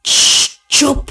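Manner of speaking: sharp shushing, cut short; irritated "shh" and curt order; scolding hush. A narrator's reading. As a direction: silence someone mid-recital. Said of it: "अब्बा डांटते डांटते रुक गए".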